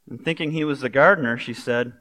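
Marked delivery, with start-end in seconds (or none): none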